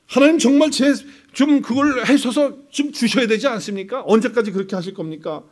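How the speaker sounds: high, loud nagging plea to God; pushy pleading. Retold or imitated. Believer nagging God to answer, loud high pleading. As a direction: wheedle and push, voice up and loud. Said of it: "하나님을 설득하는 기도를 해요".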